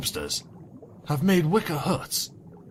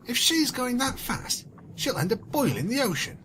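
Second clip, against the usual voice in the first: higher, sneering character voice; contemptuous; 10 semitones higher than usual. Acted. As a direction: scoff at her friend with contempt. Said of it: "snapped her claws in contempt".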